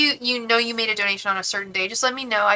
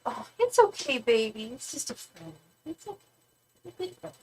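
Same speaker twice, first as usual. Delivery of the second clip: soothing; 3 semitones higher, 7 dB quieter than usual. Real, not acted. Soothing someone off-screen.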